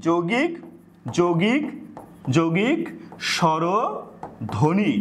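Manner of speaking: যৌগিক repeated, each one rising, then স্বরধ্বনি drawn out very slowly; teacher drilling the term with a long drawn-out ending; slow emphatic repetition. Shown as a demonstration. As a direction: hammer the term home word by word. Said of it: "খেয়াল করো".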